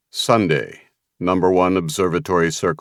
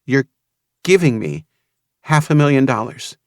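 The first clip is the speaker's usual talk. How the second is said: raised, climbing woman's voice, amazed; joyful disbelief; 8 semitones higher than usual. A narrator's reading. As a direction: let the disbelief lift into joy. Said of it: "combination of joy and incredulity".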